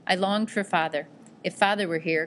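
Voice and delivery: plain reading voice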